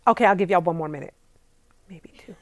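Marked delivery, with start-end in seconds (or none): 1.9-2.4 a much softer sneaky whisper; whispered aside. Pretend. To her viewers, stretching the time limit, whispered aside. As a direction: slip it in like a guilty secret.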